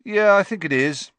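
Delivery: off-hand. A narrator's reading. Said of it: "in an off-hand sort of way".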